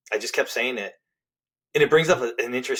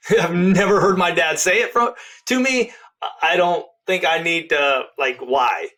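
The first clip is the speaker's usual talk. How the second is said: higher, louder protest; protesting refusal; 6 semitones higher, 5 dB louder than usual. Retold or imitated. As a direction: push back hard, as if God asked too much. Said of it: "I'm like".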